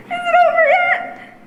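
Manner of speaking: high, timid frightened plea; scared high voice. Acted. Scared student asks if the story is over, frightened. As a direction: squeak it with eyes shut.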